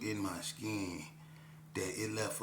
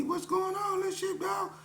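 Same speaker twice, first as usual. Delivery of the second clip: much higher, lightly voiced greeting of Train; voicing Train; 4 dB louder than usual. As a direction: greet the kid like an old friend.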